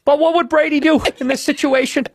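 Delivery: high, loud Boston sports fan; sports as emotional counsel; Bill Burr. Acted. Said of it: "That's my Burr".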